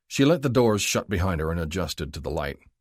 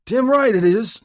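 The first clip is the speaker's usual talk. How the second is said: much higher, loud snapped agreement; angry snap; 10 dB louder than usual. A narrator's reading. Drunk snapping at the cops, angry. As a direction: spit it out angrily. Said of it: "the drunk snapped".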